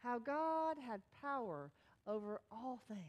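ordinary talk

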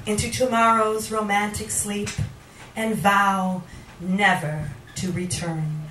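slow, measured poem delivery; poetry reading. Acted. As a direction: let each phrase hang before the next. Reads a poem aloud.

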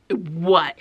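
ordinary talk, nothing marked

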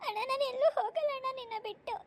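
bride wailing to her brother; tearful plea broken by sobs; sobbing. Acted. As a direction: cry through the words, clinging and pleading. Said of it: "Kaveri, ಅಳ್ಬೇಡವ".

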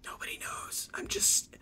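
secretive, very soft whisper; sneaky whisper. Pretend. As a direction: whisper it so nobody notices you. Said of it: "I'm sneaky and silent".

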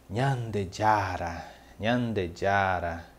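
"Nyandejara" spoken slowly and clearly, syllable by syllable; slow, careful Guarani "nyandejara"; Guarani word said slowly. Shown as a demonstration. Teacher says Nyandejara slowly for students. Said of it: "eu escutava muito meus pais usarem essa reação".

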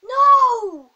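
long high yelled no; real gamer's wail of frustration; anguished shout. Real, not acted. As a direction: cry out loud in dismay. Loses the bone in game.

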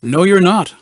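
put-on loud, high flat denial from a kid; knowing kid. Kid on the radio rejecting his lie, loud and high. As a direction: shoot it down like a know-it-all kid. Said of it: "they theorized with obvious knowledge".

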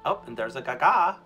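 normal talking voice

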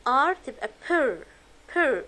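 "per" stretched very slowly, p into r; slow pr blend for beginners. Shown as a demonstration.

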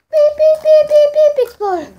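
high, loud bomb beeps; mock alarm beeping. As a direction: beep like a ticking bomb. Playful countdown to exploding.

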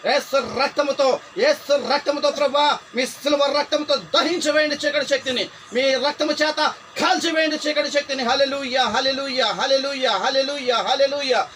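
loud, high, driving prayer shout; relentless revival-preacher roar; fiery deliverance prayer. Real, not acted. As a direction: call down fire with full authority. Shouted prayer to burn the spirit.

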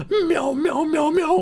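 high-pitched mock meowing; playful cat meows to Sir Meows a lot. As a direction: meow like a cartoon cat, way up high. Pretends to be cat character.

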